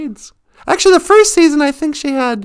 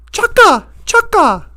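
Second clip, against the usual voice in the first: Chaka voice; 5 semitones higher than usual. Retold or imitated. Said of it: "And he goes".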